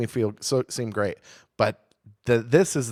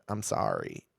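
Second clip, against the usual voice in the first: much lower and softer, sheepish sorry; mock apologetic lady; 9 dB quieter, slower than usual. Mother apologizing for taking his seat, low soft mock sorry. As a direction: drop it low and quiet, embarrassed. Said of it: "the lady's like".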